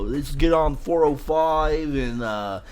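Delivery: slow, high laid-back Californian voice; mock California drawl. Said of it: "everybody talks like".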